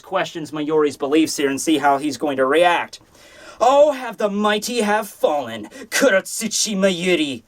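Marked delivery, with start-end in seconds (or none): 3.6-7.4 lightly voiced sneering villain, slowed right down; drawn-out taunt, only a hint of a put-on villain voice; slow mocking villain. Rival taunting the defeated scientist, slow mocking villain.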